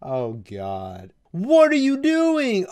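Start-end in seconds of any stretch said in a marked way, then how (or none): 1.3-2.7 high, loud, slow mock yell; hammed-up outraged teammate. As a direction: bark the line out high and stretched, hamming it up. Voicing a character's outburst.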